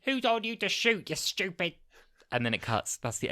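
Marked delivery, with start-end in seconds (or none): 0.0-1.7 high, loud put-on soldier voice; mock army officer scolding his men, hammed-up; mock soldier shout. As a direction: bark it like a cross officer, pitched up. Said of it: "their reaction is just".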